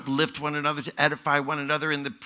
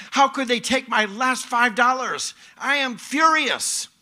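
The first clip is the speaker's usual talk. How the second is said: high, loud mock fury; acted outrage; 9 semitones higher, 5 dB louder than usual. Preacher voicing someone robbed of their last five dollars, outraged. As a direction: play outraged disbelief, pitched right up. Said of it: "You'd be outraged".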